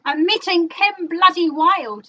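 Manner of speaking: bigger boast. Real, not acted. Repeating news with more emphasis.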